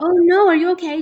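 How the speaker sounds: real alarm. Real, not acted. Cohost stabbed himself.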